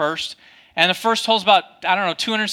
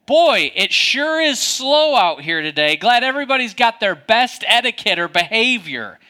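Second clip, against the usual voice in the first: raised pitch, pointedly passive-aggressive; 5 semitones higher, 4 dB louder than usual. Retold or imitated. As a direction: drag out the sarcastic compliments, higher voice. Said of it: "Passive-aggressive".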